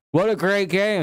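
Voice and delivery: high, sarcastic mocking fan voice; sarcastic mock quote. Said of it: "People being like".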